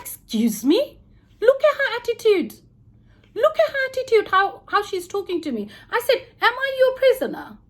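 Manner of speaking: retold outrage at the guard's attitude; indignant outrage. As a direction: complain to the supervisor in outrage. Retold complaint about the guard.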